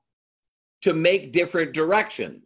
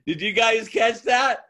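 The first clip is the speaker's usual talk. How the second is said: higher, punchy question to the crowd; excited call-out; 7 semitones higher than usual. Real, not acted. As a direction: nudge the audience, share the joke. Punchline to audience after laughter.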